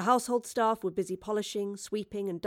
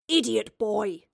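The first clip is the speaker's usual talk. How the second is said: put-on sharp yell at the boy; yelled insult; 5 dB louder, slower than usual. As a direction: snap it at the clumsy boy. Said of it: "yelled Charles".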